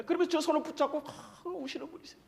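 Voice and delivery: high, soft voice close to tears; choked up. Real, not acted. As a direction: trail away softly, overcome with emotion. Pastor recalling a grieving, weeping elder's story. (울먹이는 목소리)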